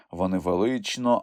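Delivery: usual speaking voice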